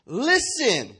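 loud, high command; stern rebuke. Acted. Jesus rebukes the evil spirit, commanding. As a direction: call the spirit sharply to attention. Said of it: "he rebuked the evil spirit".

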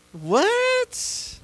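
put-on a high, squeaked "What?" like a baby bird; cartoon eaglet startled; squeaky surprised what. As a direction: pop up into a tiny high voice in mock surprise. Squeaky mock eaglet reaction.

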